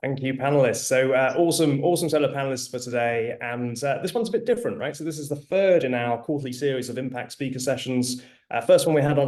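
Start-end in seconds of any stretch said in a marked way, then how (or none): none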